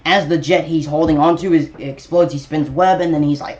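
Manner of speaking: ordinary talk, nothing marked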